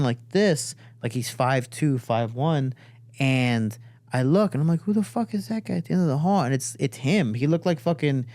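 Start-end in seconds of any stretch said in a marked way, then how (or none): 4.8-6.4 high, quick startled question. Retold or imitated. Brother shocked at the hunched figure down the hall, high and fast. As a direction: blurt it out at the stranger in the hall.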